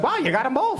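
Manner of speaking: high, light mock compliment; mimicking Brent. Comic imitates Brent complimenting, high and chirpy. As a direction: chirp it like an easily pleased friend. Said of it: "He'd be like".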